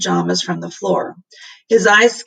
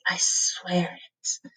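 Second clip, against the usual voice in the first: slow hushed whisper; whispered vow; 9 dB quieter, much slower than usual. Acted. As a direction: breathe the oath softly. Solemn oath, unvoiced.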